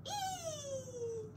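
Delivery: put-on very high, soft baby squeal of joy; joyful squeal. A narrator's reading. Baby splashing in mud, a tiny joyful squeal. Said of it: "squealed with joy".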